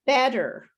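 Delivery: "Better" with the T softened to a quick tap; "Better" as a demo word, no crisp T; no T sound. Shown as a demonstration. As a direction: say "Better" American style, like "bedder". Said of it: "There's no sound of T".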